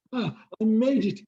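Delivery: out of breath from running, gasping the words; breathless. Acted. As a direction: puff it out like he just ran all the way. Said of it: "showed up like a breathlessly".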